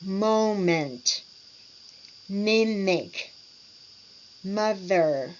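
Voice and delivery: "Moment, mimic, mother" with long, humming M sounds; over-articulate each word, holding the M vibration; over-articulated M. Shown as a demonstration. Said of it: "extend the M and N vibration".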